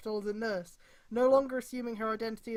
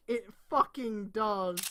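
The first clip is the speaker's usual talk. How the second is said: normal talking voice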